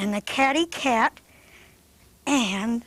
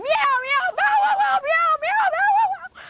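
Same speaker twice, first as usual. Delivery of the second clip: very high, loud meows and barks; a frantic cat-and-dog scuffle; squealing animal chase; 4 dB louder than usual. Acted. Dog chases the cat off, squealing meows and barks.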